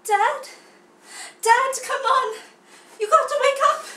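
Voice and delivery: high, loud young cub in a put-on accent; overacted accented cry to wake Dad. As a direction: plead loudly in an affected accent. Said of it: "a couple different accents in there".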